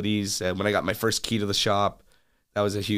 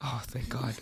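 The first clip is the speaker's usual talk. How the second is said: whispered relief; 2 semitones higher, 11 dB quieter than usual. Real, not acted. Relief at a standard Camaro.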